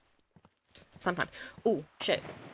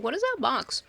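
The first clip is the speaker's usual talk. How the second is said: surprised; 7 semitones higher, 4 dB louder than usual. Retold or imitated. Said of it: "I was like".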